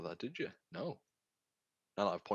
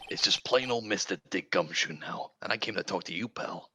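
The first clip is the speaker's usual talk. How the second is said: detective voice; 8 dB louder than usual. Acted. Voicing Gumshoe.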